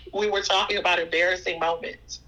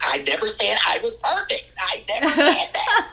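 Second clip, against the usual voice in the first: laughing protest; 4 semitones higher, 4 dB louder than usual. Real, not acted. Laughing self-defense to daughter.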